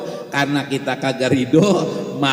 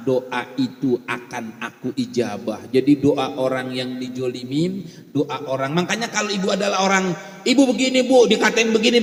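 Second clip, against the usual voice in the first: ordinary talk, nothing marked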